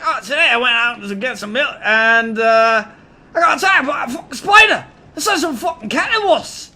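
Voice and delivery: high, loud Aussie vlogger yarn, building to shouts; exaggerated Australian accent. Australian guy vlogging about everyday life, broad Australian accent. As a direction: tell it as a hammed-up, excitable Australian. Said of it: "best Australian accent that you ever heard".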